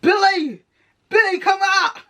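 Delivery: calling out. Acted. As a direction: shout her name up from the street. Brad calls up to window.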